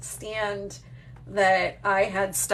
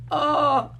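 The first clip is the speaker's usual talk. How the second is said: a flat, deflated little oh, pitched up; mock letdown oh; 7 semitones higher, 6 dB louder than usual. Imagining the letdown after twenty minutes, a mock deflated oh. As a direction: pretend the thrill has worn off. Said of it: "and then I would be like".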